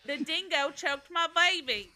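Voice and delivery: joking Australian movie line; Australian accent. Pretend. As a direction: blurt it in a mock Australian voice. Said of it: "Why are you from Australia now".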